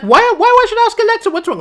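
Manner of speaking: high fast pleading whys; exasperated. Retold or imitated. User pleading with Siri, exasperated. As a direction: whine it in quick panic. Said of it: "I'm like".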